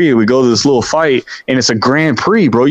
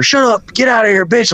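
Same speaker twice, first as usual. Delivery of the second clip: retold scream; 8 semitones higher than usual. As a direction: imitate a dude screaming at someone. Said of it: "he's sitting there screaming at her".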